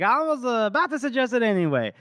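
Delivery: hammed-up awkward agreement; mock flustered husband. Voices flustered husband agreeing hastily.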